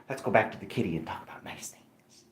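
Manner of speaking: soft hushed whisper. Acted. Owner whispering sweetly to the cat Popeye. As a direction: hush it down to a whisper.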